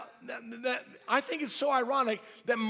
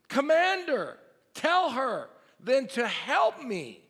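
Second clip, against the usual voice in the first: rising, louder, higher indignant plea; indignant demand; 5 semitones higher, 4 dB louder, slower than usual. Acted. Martha demanding Jesus make her sister help, indignant and loud. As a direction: alone pushed up high, like a wronged sister.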